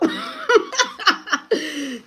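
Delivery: high, loud run of laughter; real laugh. Real, not acted. Laughing about a sleep spell, high loud cackle. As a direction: crack up in disbelief.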